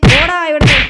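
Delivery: loud high shout to chase him off; furious kid yelling get out; angry shout. Acted. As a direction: yell at him to get lost. Chasing rival out of house.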